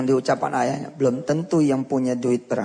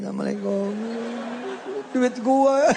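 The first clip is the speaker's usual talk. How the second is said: mock lament; 7 semitones higher than usual. Mimics child waving, money gone.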